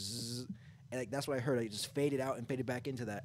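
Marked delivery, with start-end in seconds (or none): 0.0-0.5 softer, fading buzz; imitated buzz. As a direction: let the buzz drop away. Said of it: "That's all you hear is just".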